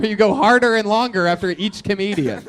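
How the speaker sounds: laughing. Real, not acted. Host laughing at comedians.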